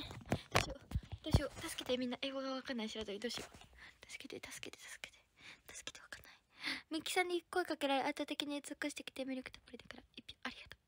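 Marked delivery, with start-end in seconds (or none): 3.2-6.3 hushed, helpless repeated whisper; soft voiceless pleading; whispered plea for help. Real, not acted. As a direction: whisper for help, stuck on the English.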